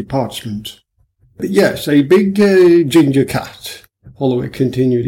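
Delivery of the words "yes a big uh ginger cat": lightly voiced hearty policeman, slow and louder; booming, easygoing police officer; a touch of a jovial superintendent; genial superintendent.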